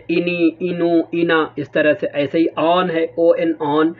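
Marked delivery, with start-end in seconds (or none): 0.1-1.5 انی, انو, اینا each with a vowel after the n; vowels added after in, انی انو اینا; wrong vowel demo. Shown as a demonstration. Teacher showing wrong readings of in. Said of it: "کوئی movement نہیں دے رہے ہیں کہ".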